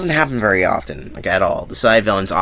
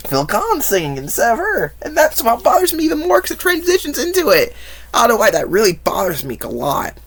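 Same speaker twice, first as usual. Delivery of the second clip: high-pitched rant; 14 semitones higher than usual. Real, not acted. Ranting about [name].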